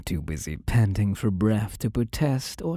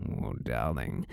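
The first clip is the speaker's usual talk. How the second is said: low murmur; 11 semitones lower, 9 dB quieter than usual. Acted. Captor teasing a restrained partner.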